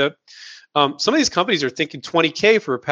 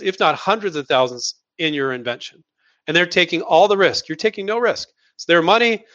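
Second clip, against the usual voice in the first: ordinary delivery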